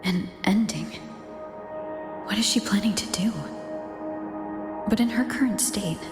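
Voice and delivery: low, whispery thinking aloud; low, breathy inner question; hushed musing. Acted. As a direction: murmur a private worry to yourself. Hushed inner thought about her.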